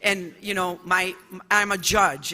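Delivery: ordinary delivery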